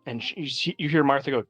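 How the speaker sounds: ordinary talk, nothing marked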